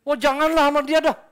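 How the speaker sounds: high, loud begging not to be paired; loud whiny plea. Retold or imitated. Churchgoer begging the leader not to serve with someone, loud whine. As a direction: whine loudly to get out of it. Pleading to avoid a person.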